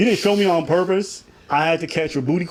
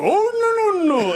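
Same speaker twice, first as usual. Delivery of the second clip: mock high protest; slower than usual. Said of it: "and you go".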